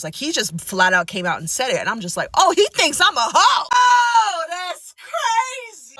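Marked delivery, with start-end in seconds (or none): 2.3-5.9 very high, loud, hammed-up disbelief; mock-outraged shriek. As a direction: wail it in exaggerated shock, stretching the last words. Acted inner outrage at boyfriend's admission.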